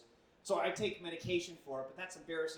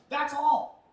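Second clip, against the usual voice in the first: exasperated protest; 10 semitones higher, 11 dB louder, slower than usual. Retold or imitated. Defending himself, exasperated.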